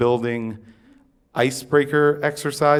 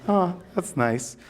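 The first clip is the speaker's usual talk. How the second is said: polite reply; 5 semitones higher, 5 dB quieter, slower than usual. Retold or imitated. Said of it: "people will, like, politely".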